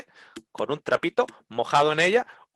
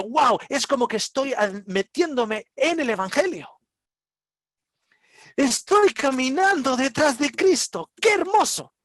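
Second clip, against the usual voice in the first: awed delight; 9 semitones higher, 3 dB louder, slower than usual. Retold or imitated. Acts out a believer's joyful thought.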